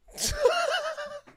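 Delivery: high, gleeful burst of laughter; delighted laugh. Real, not acted. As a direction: laugh out of sheer joy after the goal. Laughing after the goal.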